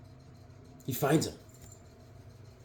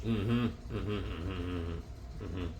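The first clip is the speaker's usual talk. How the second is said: low, soft grunting mm-hmm; Sling Blade grunt; 8 semitones lower, 14 dB quieter, slower than usual. Retold or imitated. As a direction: grunt it like a slow, quiet drifter. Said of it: "Like Sling Blade".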